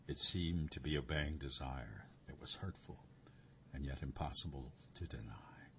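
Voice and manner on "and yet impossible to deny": ordinary delivery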